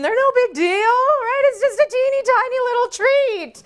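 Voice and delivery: high-pitched comic denial of any guilt; mock self-justifying. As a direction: talk yourself into it, voice climbing way up. Mock self-persuasion about candy.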